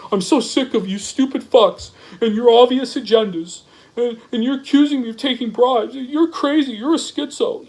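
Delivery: high, whiny mockery of the rant; sneering high-pitched parody of an upset poster; mocking whiny imitation. Mocks the rival's post right after.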